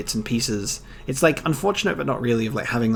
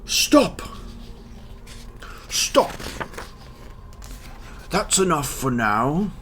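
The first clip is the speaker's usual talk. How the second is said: sharp calls, jumping much higher; soldiers and lord; 4 semitones higher than usual. Acted. Guards cry out, lord asks about the weapons, sharp. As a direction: bark the soldiers' orders, then the lord's question.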